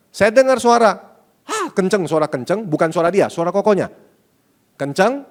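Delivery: alarmed retelling. Retold or imitated. Retelling hearing a shout upstairs.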